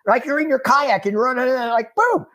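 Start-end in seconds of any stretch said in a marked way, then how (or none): 1.9-2.2 excited. Retold or imitated. Otter pops up suddenly.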